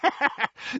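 high, short chuckle of relish; delighted old aunt's laugh; gleeful cackle. A narrator's reading. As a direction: cackle at the scandal. Aunt relishes the brawl.

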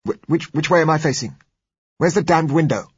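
stammered start, high and loud; irritable demand. A narrator's reading. Blind doctor demanding the window, irritable. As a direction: demand it loudly, temper flaring. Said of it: "demanded with a return of irritability".

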